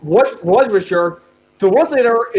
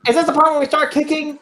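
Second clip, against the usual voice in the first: high, eager cartoon sponge; SpongeBob voice; 8 semitones higher, faster than usual. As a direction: bounce it up like SpongeBob.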